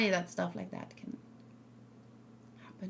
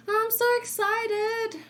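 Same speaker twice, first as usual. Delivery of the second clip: high, drawn-out excited squeal; put-on excitement; 12 semitones higher, slower than usual. Voicing excitement for the new banner, high excited squeal. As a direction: stretch it out slowly in a much higher, put-on voice.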